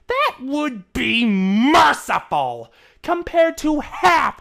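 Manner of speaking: high, loud, measured list of charges; stern accusation. Acted. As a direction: brother threatening punishment. Shining lists Trixie's crimes.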